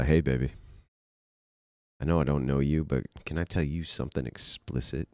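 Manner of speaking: mock seductive. Trying out seductive pickup line.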